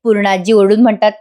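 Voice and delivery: ordinary talk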